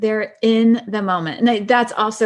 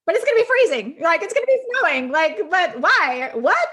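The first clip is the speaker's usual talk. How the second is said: incredulous; 10 semitones higher than usual. Real, not acted. Mock outrage about winter meeting.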